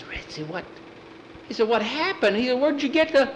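high, breaking frightened questions; frightened boy. Acted. Boy finds blood on his dog, high frightened questions. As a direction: plead with the dog in fear.

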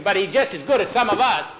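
put-on high, loud boyish objection; boy's insistence. Boy arguing his dog's worth, high insistent voice.

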